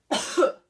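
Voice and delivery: sharp loud cough; demo cough. Shown as a demonstration. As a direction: give one hard cough to start. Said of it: "like a cough".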